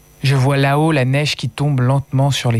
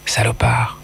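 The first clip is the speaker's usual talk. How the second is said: whispered insult into his ear; a sharp whisper to her dead father; 4 semitones lower than usual. Acted. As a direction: hiss it right at his ear. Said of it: "Je murmure à l'oreille de mon père".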